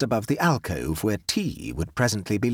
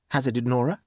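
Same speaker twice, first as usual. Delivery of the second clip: plain reading voice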